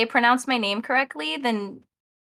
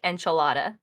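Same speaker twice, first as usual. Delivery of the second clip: anglicized Spanish; 7 semitones lower, 4 dB quieter than usual. Retold or imitated. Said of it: "I'm probably gonna say".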